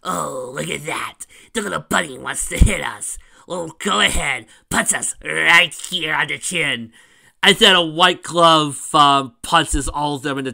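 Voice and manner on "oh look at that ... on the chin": loud laughing jeers, slowing into taunts; mocking villain laugh. Changelings taunting Cream, loud mocking laughter. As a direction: sneer at the little bunny. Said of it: "the changelings laugh, going".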